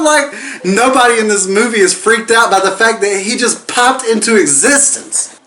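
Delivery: worked-up rant. Real, not acted. Rant about missing dad.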